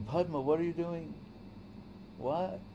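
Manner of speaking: a husband confused to find his wife eating; puzzled husband. Retold or imitated.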